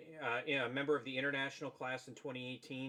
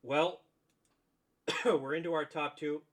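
coughing through the line; choked, coughing mid-sentence; choking; 3 semitones higher, 6 dB louder than usual. Real, not acted. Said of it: "I'm choking".

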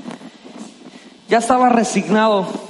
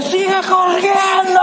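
coach's shout; 12 semitones higher, slower than usual. Retold or imitated. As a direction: yell it down from the stands. Coach yelling from the stands.

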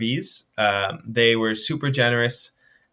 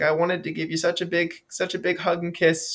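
higher, lightly put-on great-grandmother voice; a kindly great-grandmother praising the young runner, higher; sweet old lady; 5 semitones higher, 4 dB quieter than usual. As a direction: play a doting great-grandmother, pitched up. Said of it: "probably a great-grandmother".

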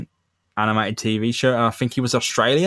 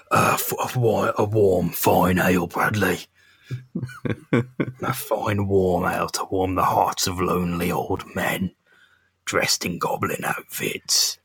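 low, slow drawn-out goblin voice; Green Goblin voice; 3 semitones lower, slower than usual. Acted. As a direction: play a lonely old goblin. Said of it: "How are you hearing him?".

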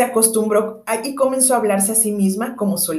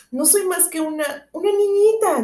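hesitant; 8 semitones higher than usual. A narrator's reading. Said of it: "dijo Alicia con cierta vacilación".

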